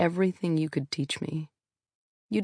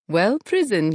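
put-on father's voice in a heavy Russian accent; imitating Dad's heavy Russian accent; 5 semitones higher, 5 dB louder than usual. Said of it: "said in a heavy Russian accent".

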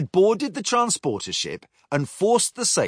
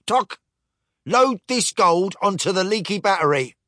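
higher clicking robot voice; clockwork first mate; 7 semitones higher, 3 dB louder than usual. Acted. Clockwork mate hauls the chest, higher mechanical voice. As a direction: tick and click like a wind-up toy.

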